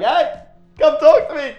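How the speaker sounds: high, loud call for help. Retold or imitated. Calling across the room to rescue him, high and loud. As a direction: wave someone over with a bright high call.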